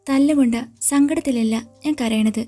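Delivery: plain reading voice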